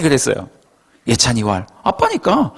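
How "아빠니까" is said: put-on cheeky child. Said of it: "너무 뻔뻔해요".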